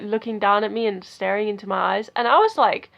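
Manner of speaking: ordinary talk, nothing marked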